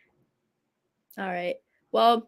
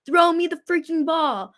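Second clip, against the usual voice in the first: higher-pitched mock yell, not full volume; retold yell; 5 semitones higher than usual. Host quoting George Pickens during the game, mock yell. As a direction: quote it as a light imitated holler.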